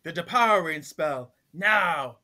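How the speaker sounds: commanding shout. Retold or imitated. Piper ordering her sisters to cast, loud commanding call. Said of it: "she's like".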